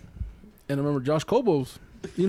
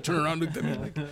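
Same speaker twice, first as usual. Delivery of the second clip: ordinary talk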